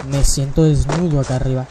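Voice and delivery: normal talking voice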